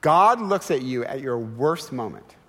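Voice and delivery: usual speaking voice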